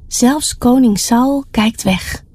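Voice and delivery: plain reading voice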